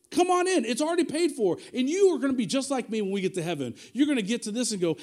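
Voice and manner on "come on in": welcoming call. Shown as a demonstration. Pastor inviting everyone to God's table, calling out. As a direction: come on in, higher and louder.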